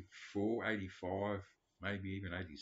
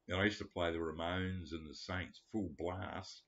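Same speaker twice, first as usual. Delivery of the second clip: ordinary talk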